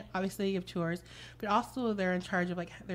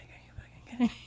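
stunned whisper; 4 semitones higher, faster than usual. Retold or imitated. Stunned coworkers in the break room, whispering. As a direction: whisper it in disbelief.

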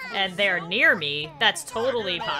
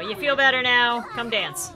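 ordinary delivery

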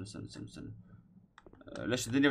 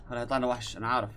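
normal talking voice

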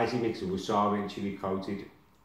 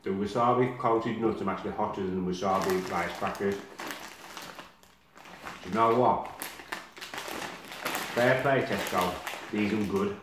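speaking between bites; talking while chewing; slower than usual. Real, not acted. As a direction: mouth partly full of nuts. Seen 2: Ate a snack just before; mouth working between phrases, holds more.

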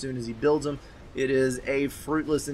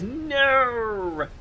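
high, long-drawn playful "no"; hammed-up wail, pitched high and falling; mock cry of no; 8 semitones higher than usual. Caster watching to see who dies, mock dismay.

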